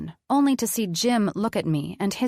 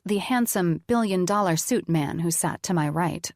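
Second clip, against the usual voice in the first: plain reading voice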